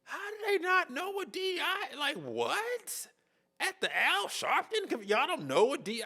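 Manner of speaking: can't believe what you just heard; disbelief. Real, not acted. Disbelief at clueless interviewees.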